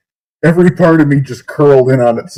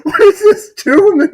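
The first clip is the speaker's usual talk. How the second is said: laughing disbelief. Real, not acted. Reacting to song in disbelief.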